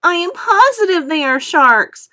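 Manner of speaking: cling to it in fear; panicky insistence. A narrator's reading. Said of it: "said the earthworm".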